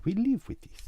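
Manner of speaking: high, exaggerated Italian accent; hammed-up comic Italian accent. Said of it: "Italian accent".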